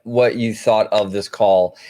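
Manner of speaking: ordinary talk, nothing marked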